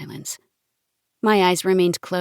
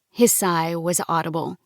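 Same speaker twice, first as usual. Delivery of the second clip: plain reading voice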